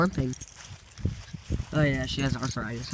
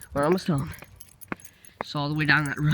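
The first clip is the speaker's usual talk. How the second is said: ordinary talk, nothing marked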